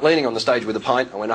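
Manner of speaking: ordinary talk, nothing marked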